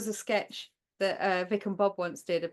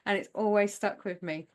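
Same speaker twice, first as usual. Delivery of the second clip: ordinary talk, nothing marked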